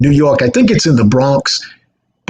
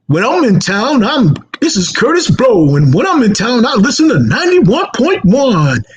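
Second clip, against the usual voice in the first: higher, showy rapper promo voice; 8 semitones higher than usual. Retold or imitated. Rapper recording a station promo, high showy promo voice. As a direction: pitch it up like a hyped radio drop. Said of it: "you don't even sound like that when you're talking".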